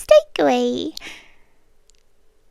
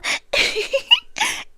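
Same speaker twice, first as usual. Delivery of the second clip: a quick amused laugh that jumps up high; amused little laugh; short high laugh; 4 dB quieter than usual. Acted. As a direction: let a short, pleased laugh slip out. Captor amused by own remark.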